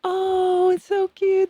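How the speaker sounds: high, loud cooing gush. Retold or imitated. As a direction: coo over the baby, loud and high. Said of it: "and like".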